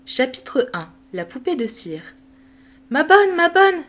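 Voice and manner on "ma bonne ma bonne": high, louder excited call; a little girl calling her nurse eagerly; little girl excitedly calling her nurse; eager child calling. Acted. Sophie calls eagerly for nurse.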